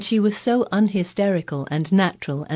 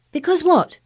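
high, eager, impatient question; eager cry; 12 semitones higher, 6 dB louder than usual. A narrator's reading. Colin urging Mary to go on, eager and high. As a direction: jump in eagerly, dying to know. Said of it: "he cried eagerly".

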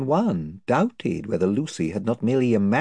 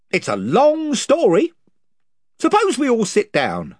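higher, blustering chief; invisible chief; 12 semitones higher, 5 dB louder than usual. Acted. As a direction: boast of his band's numbers.

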